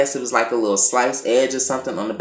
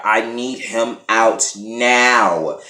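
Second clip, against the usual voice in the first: slow insistent demand; much slower than usual. Retold or imitated. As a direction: drag out each word firmly. Jim demanding release from jail.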